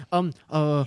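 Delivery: low, hesitant imitation of a bad liar; Bobby's stuttering. Friend mimicking Bobby caught lying, hesitant stammer. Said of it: "he stutters like fucking crazy".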